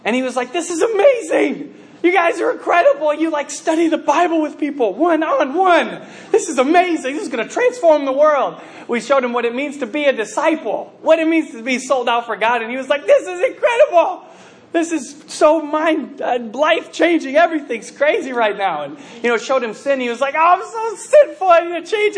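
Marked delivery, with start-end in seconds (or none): 0.5-8.5 imitating wide-eyed amazement, high-pitched; excited convert. New Bible student amazed by what he's shown, gushing excitedly. 13.0-18.8 mock gushing, high-pitched at first, then easing; amazed convert. Student shown what discipleship means, overexcited. 20.3-22.2 hammed-up high, loud confession; overwhelmed convert. Student shown his sin, crying out in exaggerated remorse.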